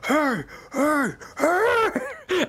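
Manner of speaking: high-pitched demon hollering; demon's call from behind. Acted. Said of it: "you can hear from behind you".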